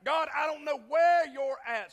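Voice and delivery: retold anguished cry. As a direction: cry out in replayed frustration. Retold burdened cry to God.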